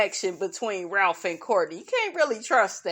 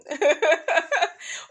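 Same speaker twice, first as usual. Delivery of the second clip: laugh; 6 semitones higher than usual. Real, not acted. Laughing at own joke.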